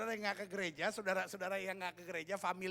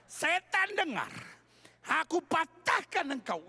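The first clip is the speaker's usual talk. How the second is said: loud, high commanding shout; acted deliverance-preacher shout; exorcist command; 8 semitones higher, 8 dB louder, slower than usual. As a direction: shout it at the devil, drawn out. Acts out exorcist commanding Satan.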